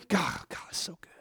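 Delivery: breathed almost in a whisper, very soft; hushed awe. Real, not acted. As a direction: drop to an overwhelmed whisper. Overcome by what he reads.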